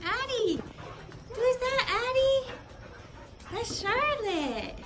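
high sing-song voice for the dog; pet talk. Real, not acted. To dog Addie, introducing the puppy, high cooing pet talk.

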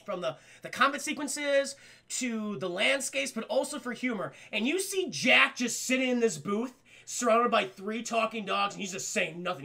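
usual speaking voice

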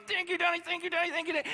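put-on high, squealing little girl thanking her daddy; excited little girl. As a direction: play the little girl, thrilled with her new cat. Little daughter voiced, ecstatic.

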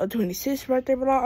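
ordinary delivery

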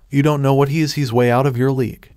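ordinary delivery

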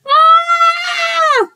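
high, sustained "ah" shriek; yelled scream. A narrator's reading. Second scared kid screams back, high frightened yell. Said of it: "yelled Carrie".

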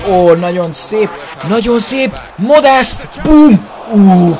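high, loud excited yell; excited shout. Real, not acted. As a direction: cheer the shot at full voice. Celebrating a shot.

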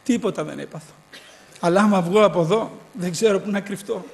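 ordinary delivery

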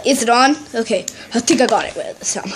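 ordinary talk, nothing marked

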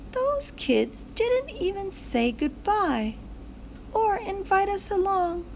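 put-on high childlike character voices; high excited kid voices in a story. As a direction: play the kids and sign kids eagerly. Voices kids and signs.

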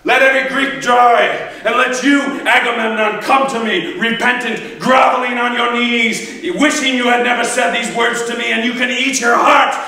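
high, loud sworn oath building to a shout; furious rage. Acted. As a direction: thunder a vow of fury, climbing to a full roar. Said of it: "he flies into a rage".

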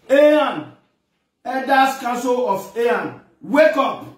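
urgent public call-out. Real, not acted. Calling on the elders to wake.

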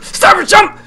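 high, loud frantic yells; panicked shouting. Real, not acted. Player yelling as the character is falling, panicked shouting. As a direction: cry out in real alarm as you drop. Screaming at jump and fall.

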